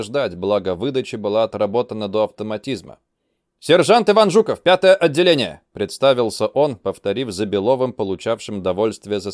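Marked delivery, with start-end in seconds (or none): 3.6-5.6 high, loud calling out; shouted roll call. Acted. Recruit reports himself.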